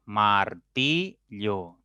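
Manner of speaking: very slow, mar-ti-llo; split into syllables. Shown as a demonstration. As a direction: martillo spoken in three slow separate beats. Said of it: "con golpes de voz pausados".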